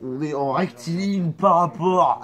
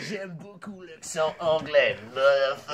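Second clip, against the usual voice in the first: mock English accent; 3 dB quieter than usual. Shown as a demonstration. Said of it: "J'arrive à bien faire l'accent anglais".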